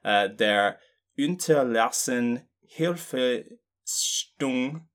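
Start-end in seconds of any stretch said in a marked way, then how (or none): 1.2-4.8 put-on slow, drawn-out German accent. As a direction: say it like a native German, slowly. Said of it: "I put on my German accent".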